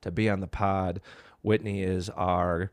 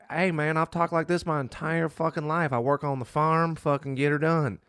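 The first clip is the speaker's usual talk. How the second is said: higher-pitched put-on Southern farm twang; downstate Illinois rural accent; 5 semitones higher than usual. Co-host imitates people south of I-eighty, rural Southern accent. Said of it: "you're gonna hear people that talk like".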